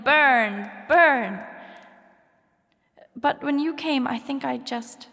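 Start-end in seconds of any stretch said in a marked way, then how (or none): 0.0-1.4 loud taunting yell of burn; mock yelling. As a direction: yell it out as a cruel boy taunting. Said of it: "laughing and yelling".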